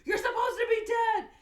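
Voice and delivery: very high, loud shocked scream; acted screaming. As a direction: scream it at your double. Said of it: "he's, like, screaming".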